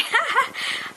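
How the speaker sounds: a squealing giggle, high up; giggling helplessly at the joke; high-pitched giggle. Acted. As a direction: let a shrill giggle escape.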